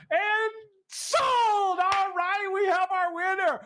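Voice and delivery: high, drawn-out winner announcement; triumphant announcer. Pretend. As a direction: belt it out high like an auctioneer.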